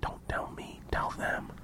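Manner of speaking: hushed off-mic prompt; secretive whispered coaching; whispering. Real, not acted. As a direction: whisper the instruction so listeners won't hear. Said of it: "You're whispering".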